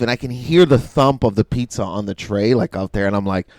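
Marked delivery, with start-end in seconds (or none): none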